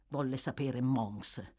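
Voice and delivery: ordinary delivery, nothing marked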